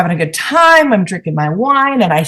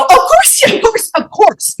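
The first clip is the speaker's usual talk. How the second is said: high-pitched mock-exasperated cry; retold meltdown, pitch leaping up; exasperated outburst; 10 semitones higher than usual. Customer retelling her meltdown at the waiter, exasperated.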